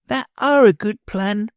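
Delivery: put-on excited child's cry; exclaiming. A narrator's reading. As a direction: Bruno perks up with a bright exclaim. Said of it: "he exclaimed".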